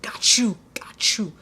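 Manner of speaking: a quick hushed aside, I've got this; whispered reassurance. Retold or imitated. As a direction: whisper it to your coworker so the customer can't hear.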